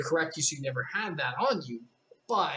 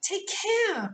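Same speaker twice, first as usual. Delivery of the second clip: much higher urgent cry; an alarmed high call; cried out. Acted. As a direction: warn her sharply. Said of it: "cried Alice".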